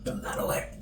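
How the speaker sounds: hushed whispered aside. Real, not acted. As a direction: whisper it off-mic. Aside while eating on camera.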